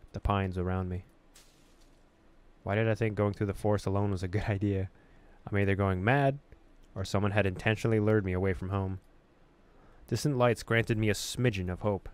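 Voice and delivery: ordinary delivery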